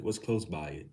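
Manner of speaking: ordinary delivery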